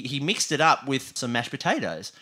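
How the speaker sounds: normal talking voice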